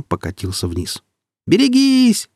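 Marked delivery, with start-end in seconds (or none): 1.5-2.3 high, loud drawn-out shout; yelling a warning. A narrator's reading. Marek warns Wulfer of the falling boulder, yelling. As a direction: yell it out as an urgent warning. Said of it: "завопил Марек".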